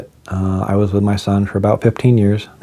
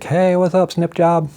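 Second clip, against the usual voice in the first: teasing coworkers; 9 semitones higher than usual. Retold or imitated.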